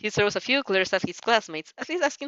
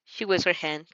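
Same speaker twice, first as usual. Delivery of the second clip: plain reading voice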